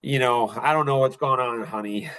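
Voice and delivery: ordinary delivery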